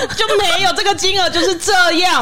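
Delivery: high-pitched, worked-up pleading insistence; exasperated helplessness. Acted. Groomer re-arguing with a customer who wants free trimming, helpless exasperation. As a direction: plead helplessly, pitched well above normal. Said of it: "他刚刚真的有无助感欸".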